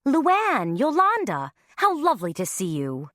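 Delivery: gushing greeting. A narrator's reading. Mom greets hens sweetly.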